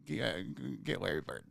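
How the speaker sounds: very quiet, low acted advice; soft, low, hesitant urging; hushed aside. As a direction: murmur it like confidential encouragement. Acts out advice to his mom.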